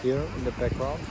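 talking around a toothbrush; while brushing teeth. Real, not acted. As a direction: mouth full of toothbrush. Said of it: "I'm brushing my teeth right now".

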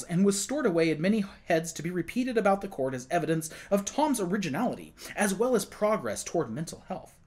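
plain reading voice

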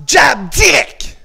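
loud, high, drawn-out command; shouted drill call. Real, not acted. Coach leading combat drill, slow forceful shout. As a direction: shout each punch as it lands.

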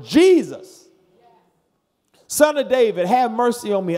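loud crying out to Jesus; hollered plea. Retold or imitated. Blind man crying out to Jesus, loud holler. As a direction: holler it across the road. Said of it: "he hollered".

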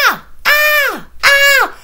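high shrieking cries; screaming. A narrator's reading. Girl screams while running from mother. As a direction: shriek as she runs round the table. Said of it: "en criant".

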